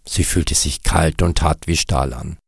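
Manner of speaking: ordinary delivery, nothing marked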